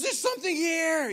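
high-pitched, very loud alarm call; urgent excited shout. Retold or imitated. Finnish teammate calling the guide over to a find, urgent shout. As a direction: yell that something big is happening. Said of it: "you can just hear in someone's voice when there's something big happening".

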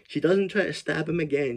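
normal talking voice

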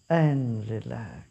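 calm, unhurried meditation guide with long pauses; guided meditation. Real, not acted. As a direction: lead them gently into stillness, phrase by phrase. (शांत, ठहराव भरा ध्यान निर्देश)

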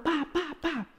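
high sharp pops, fading to soft; pa pa pa like distant shots; imitated firecracker pops. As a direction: mimic the bangs going off. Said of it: "De repente suena".

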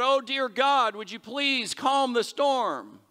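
high, loud, drawn-out plea; mock crying out. Preacher imagining Jesus begging God to calm the storm, crying out. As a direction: cry it out as a slow, hammed-up prayer. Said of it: "Does he cry out".

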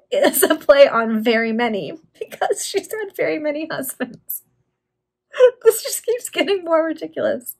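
high, giggly explanation; talking through laughter. Real, not acted. Reader explains the pun on the name, laughing. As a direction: explain it while trying not to crack up.

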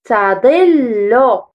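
تظل drawn out very slowly; slow syllable reading. Shown as a demonstration. Teacher sounds out the word slowly for children. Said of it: "رددوا".

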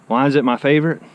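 ordinary talk

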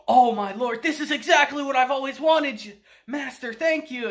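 hammed-up fawning thanks, voice climbing; ecstatic disciple. Old mage thanking his master, gushing high. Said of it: "He's like".

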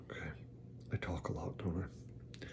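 self-mocking whisper; whispered aside. Real, not acted. Preacher admits to the congregation he talks a lot, whispered. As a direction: whisper it to the room as a joke on yourself.

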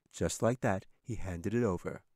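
plain reading voice

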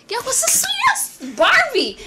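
put-on high, drawn-out childlike awe; kid marveling at toys. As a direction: squeal slowly over a favorite toy. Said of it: "look at it and be like".

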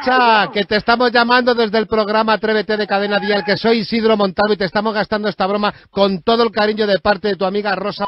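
high, loud radio host over the commotion; excited prank reveal. Real, not acted. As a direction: announce the prank at full voice.

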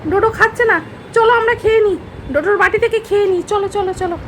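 high sing-song coaxing to a dog; high coaxing voice to a pet dog. Real, not acted. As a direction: tempt the dog by pretending to eat its food. Coaxing dog, pretending to eat.

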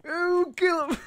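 high slow squeal; squeaky cartoon voice. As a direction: stretch the ooh in a high squeak. Squealed catchphrase.

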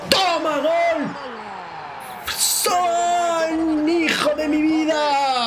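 high shouting goal cheer; goal celebration. Real, not acted. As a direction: scream the goal out.

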